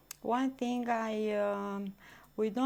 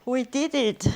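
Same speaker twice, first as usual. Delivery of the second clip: excited; 5 semitones higher, 9 dB louder than usual. Real, not acted. Celebrating after the walk.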